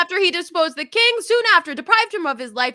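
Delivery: high, loud accusing oration; loud declamation. Acted. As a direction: hurl the charges at the king. Hotspur's speech continued loudly.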